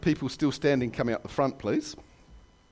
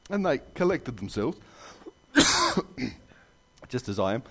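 ordinary talk, nothing marked

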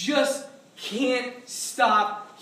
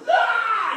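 sudden high, loud yell; attacking yell; 12 semitones higher, 7 dB louder than usual. Acted. Devil's attack crashing down on you, sudden yell. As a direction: burst out with a loud "Ah!".

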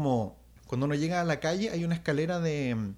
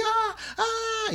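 high-pitched mock scream of fear; re-enacted shrill child's shriek; imitated terrified scream. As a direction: scream like a panicked child running away. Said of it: "salgo gritando así".

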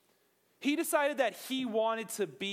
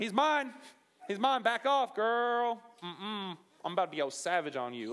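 put-on sassy jealous girl. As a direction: warn the other girl off, full sass. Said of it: "an angry girl telling other girls to back off".

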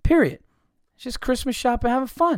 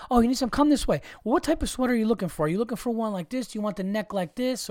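higher, quick, overeager shop clerk; imitating the salesman helping Bill Burr, eager; 5 semitones higher, faster than usual. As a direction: rattle off options like a proud salesman.

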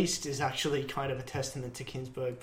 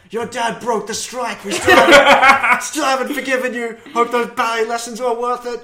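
put-on laughing, higher jeering voice of a bitter old unionist; mocking taunt; 7 semitones higher, 12 dB louder than usual. Podcaster voices an old grudge against the hero, laughing mock taunt. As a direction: sneer it through your own laughter. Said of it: "It's like".

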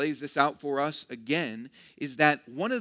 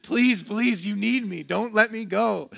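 higher, louder mock pleading; begging boyfriend; 4 semitones higher, 6 dB louder than usual. Preacher mimics a needy boyfriend to the congregation, mock begging. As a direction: plead like a clingy boyfriend, hammed up. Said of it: "a begging boyfriend".

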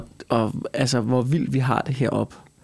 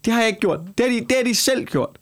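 raised, higher protesting voice; indignant; 6 semitones higher than usual. Real, not acted. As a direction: push back hard, clearly offended. Defends himself, rising indignation.

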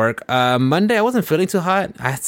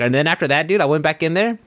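normal talking voice

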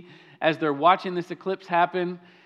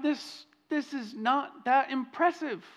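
unimpressed; 4 semitones higher, 4 dB quieter than usual. Retold or imitated. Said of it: "I just sat there and thought".

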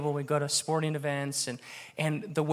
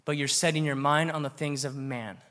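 ordinary delivery

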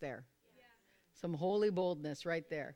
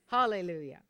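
ordinary talk

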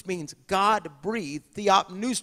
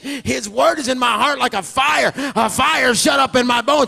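higher, louder fervent shouting; preaching shout; 5 semitones higher, 9 dB louder than usual. Acted. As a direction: roar it out like fire in the bones.

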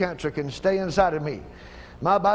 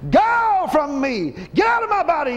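commanding shout; 8 semitones higher, 3 dB louder than usual. Real, not acted. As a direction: order it out with full force. Commanding cancer to leave.

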